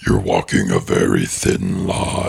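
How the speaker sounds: very low, slow menacing cave; grinding stone statue. Acted. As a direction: rumble a threat from deep stone. Plays the Cave of Wonders.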